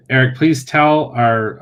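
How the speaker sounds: usual speaking voice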